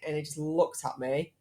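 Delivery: ordinary talk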